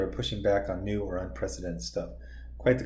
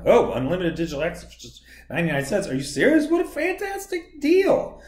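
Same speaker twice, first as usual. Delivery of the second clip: mock ad excitement; 6 semitones higher, 3 dB louder than usual. Vlogger mocking a newspaper subscription ad, sarcastic pitch. Parodying an ad read.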